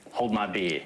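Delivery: put-on light Australian accent on "beer", said "pia" with no r; a quick Aussie take, dropping the r in "beer". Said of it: "I just love the way Australians say beer".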